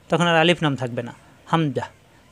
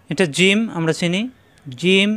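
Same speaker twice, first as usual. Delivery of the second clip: ordinary delivery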